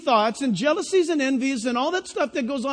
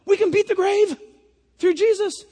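amazed; 6 semitones higher than usual. Pretend. Honest listener amazed by resurrection news, high and eager. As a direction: marvel at the news. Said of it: "an honest person will say".

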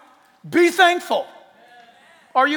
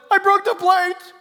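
put-on high, timid child voice; small child; 10 semitones higher, 9 dB louder than usual. Child owning up to parent, high and timid. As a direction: answer like a scared little kid.

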